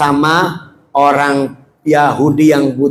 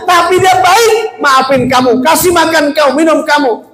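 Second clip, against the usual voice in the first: tearful outburst; 10 semitones higher than usual. Acted. Abu Bakar telling the blind man who fed him, tearful outburst. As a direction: cry out each accusation, rising in pitch. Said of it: "Gimana saya nggak nangis?".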